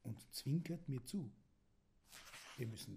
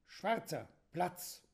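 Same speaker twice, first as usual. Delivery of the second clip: "Schwarzer" called out higher and louder, "Platz" barked as a command; 7 semitones higher, 6 dB louder, slower than usual. A narrator's reading. Child orders the wild dragon to sit, loud commanding call. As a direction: shout it at the dog-like dragon to make it sit. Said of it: "Auf meinen Zuruf".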